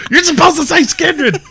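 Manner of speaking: high, laughing mock plea. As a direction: beg the crowd through laughter. Pleading with crowd, laughing.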